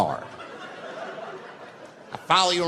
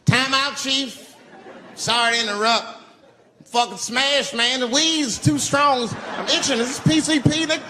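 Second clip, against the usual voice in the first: panicked; 9 semitones higher than usual. Retold or imitated. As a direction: shriek it like the weed's too strong. High panicking stoned self.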